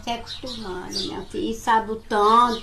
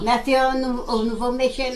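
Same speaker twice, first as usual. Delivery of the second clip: ordinary delivery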